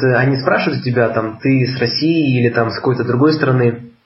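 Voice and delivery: ordinary delivery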